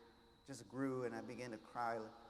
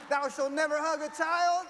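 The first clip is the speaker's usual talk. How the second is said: booming, much higher and louder decree; mock commandment; 13 semitones higher, 15 dB louder than usual. Mocking the district rule as a loud solemn commandment. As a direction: proclaim it like a biblical law.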